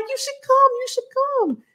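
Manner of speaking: high, bright-voiced urging; high pleading invite. Urging Thai guide to visit.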